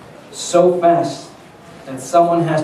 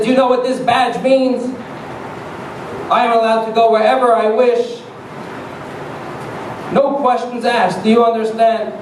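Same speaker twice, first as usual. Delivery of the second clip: higher-pitched, lightly voiced official outburst; indignant officer; 5 semitones higher than usual. Officer flashing his badge at a rancher, indignant. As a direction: pull rank with mock indignation. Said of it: "The D E A officer verbally exploded".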